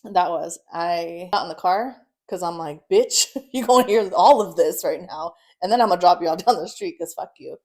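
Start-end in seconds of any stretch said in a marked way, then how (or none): 2.9-7.6 loud telling-off broken by a laugh; loud, amused re-enactment of a rant; retold angry rant. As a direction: lay into him loudly, half laughing.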